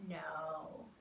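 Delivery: plain reading voice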